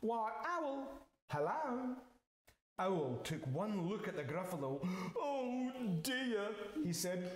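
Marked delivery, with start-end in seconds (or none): 0.0-2.0 high mouse hello; breezy mouse greeting. Acted. 5.2-6.5 high, worried owl; alarmed owl. Acted. Owl sees the Gruffalo.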